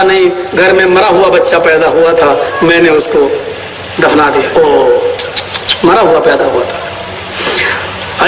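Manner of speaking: wife hiding the truth from her husband; wife's false answer. Retold or imitated. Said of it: "کہا،". (بیوی کا بہت نیچی اور بھاری آواز میں جھوٹا جواب)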